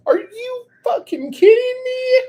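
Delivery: very high, loud squeal; thrilled disbelief. Real, not acted. Crafter reacts to the no-slip bottom, squealing with disbelief.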